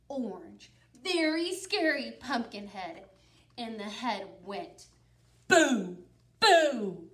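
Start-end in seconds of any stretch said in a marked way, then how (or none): 5.5-7.0 loud ghostly boo; spooky boo. Acted. Pumpkin head says boo.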